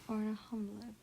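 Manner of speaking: ordinary delivery, nothing marked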